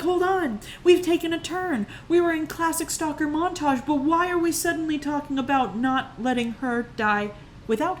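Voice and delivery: high-pitched, incredulous objection; baffled protest. Retold or imitated. Viewer objecting to sudden talk of death, baffled protest. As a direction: question the plot in high-pitched disbelief.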